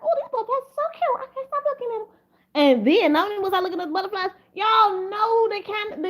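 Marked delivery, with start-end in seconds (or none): 0.0-2.1 high, cutesy gushing voice; mock girly gush. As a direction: coo like a smitten girl over butterflies. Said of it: "I was like".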